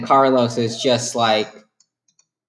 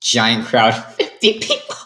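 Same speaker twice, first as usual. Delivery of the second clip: usual speaking voice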